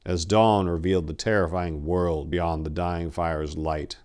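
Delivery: usual speaking voice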